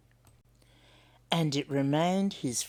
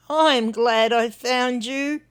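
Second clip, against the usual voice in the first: higher, louder, slow heartfelt confession; a shy bilby opening up; tender Hunwick; 7 semitones higher, 7 dB louder, slower than usual. Acted. Hunwick speaks his mind shyly.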